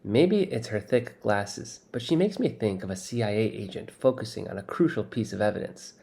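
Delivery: plain reading voice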